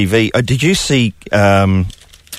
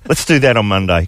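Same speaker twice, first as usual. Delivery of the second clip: normal talking voice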